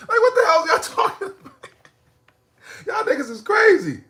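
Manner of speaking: high laughing disbelief. Real, not acted.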